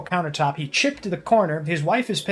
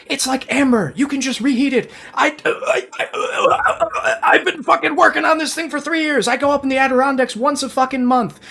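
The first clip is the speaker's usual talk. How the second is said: much higher, loud and strained with frustration; exasperated rant; 7 semitones higher, 3 dB louder than usual. Acted. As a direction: vent the outrage over the thrown-out honey. Ranting as the angry neighbor.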